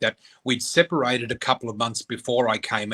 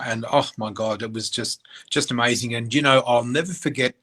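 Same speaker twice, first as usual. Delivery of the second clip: ordinary talk, nothing marked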